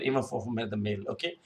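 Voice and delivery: normal talking voice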